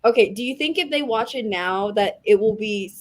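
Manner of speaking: usual speaking voice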